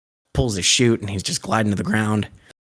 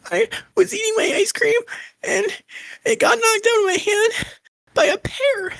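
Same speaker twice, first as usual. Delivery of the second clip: put-on very high squeaky little girl voice, near tears; tiny girl wailing about her ice cream; crying little girl. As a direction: whine it out like a sobbing small child.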